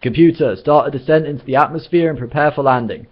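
high, loud order to the computer; shouted command. A narrator's reading. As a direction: shout the instruction out. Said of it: "shouted Zaphod".

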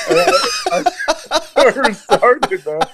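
real laughter breaking up the words; laughing hard. Real, not acted. Player cracking up at his own fight story, laughing as he talks. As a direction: talk through helpless, high-pitched laughter.

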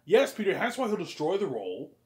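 high, loud, still fired up; heated argument. Acted. Peter and Ivan still arguing about the actor's worth, loud and high. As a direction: keep shouting your case. Quarrel continues.

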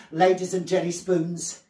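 usual speaking voice

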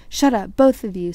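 loud, sharp command. A narrator's reading. Harry silencing the bickering pair, sharply. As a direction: cut them off firmly and loudly. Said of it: "said Harry sharply".

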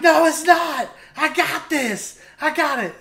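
high-pitched acted-out yell; insistent yelling. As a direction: protest loudly, high-pitched, as if retelling a panic. Retelling his panicked insistence to his niece.

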